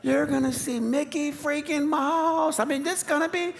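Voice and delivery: oversell it even harder, almost pleading; desperate hype. Retold or imitated. Dad pushes even harder to cheer up his sulking kids, over-the-top excited. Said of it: "you're trying to tell 'em".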